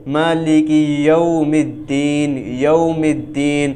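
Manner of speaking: یوم said as "yaum" with a clear diphthong, slowly; slow recitation of مالک یوم الدین; correct Arabic pronunciation. Shown as a demonstration. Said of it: "یہ مجہول ہے".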